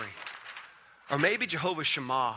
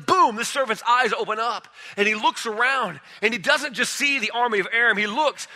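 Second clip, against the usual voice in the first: ordinary talk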